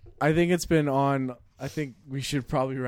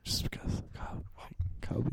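hushed whisper; 3 semitones lower, 11 dB quieter than usual. Real, not acted. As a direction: keep it secret, barely voiced. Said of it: "Why are we whispering?".

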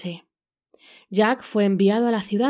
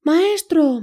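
high loud scream for help; 8 semitones higher, 4 dB louder than usual. A narrator's reading. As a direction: shriek it out in alarm. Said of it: "El arpa comenzó a gritar".

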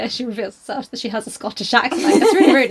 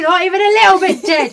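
laughing; 9 semitones higher, 6 dB louder than usual. Real, not acted. Mock outrage while laughing.

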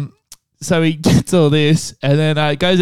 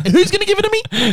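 laughing high taunt; faster than usual. Pretend. Mock taunt.

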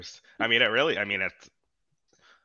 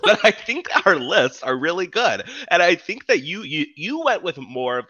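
laughing; 9 semitones higher, 8 dB louder than usual. Real, not acted.

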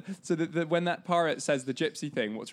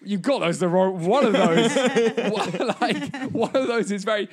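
much higher, louder outraged protest; incredulous loud; 7 semitones higher, 8 dB louder than usual. Retold or imitated. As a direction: laugh through a loud objection. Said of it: "I'm like".

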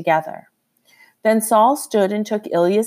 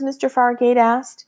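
ordinary delivery, nothing marked

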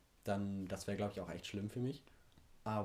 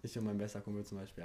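ordinary delivery

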